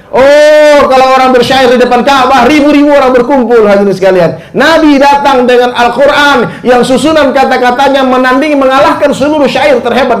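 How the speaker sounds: high, loud sermon climax; fiery preaching. Acted. Preacher stirring the congregation about the Prophet's eloquence, loud and fervent. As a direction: rise up and preach it with full force.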